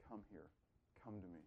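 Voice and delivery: a hushed, loving welcome; quietly beckoning; tender invitation. Acted. Jesus answers the sinner.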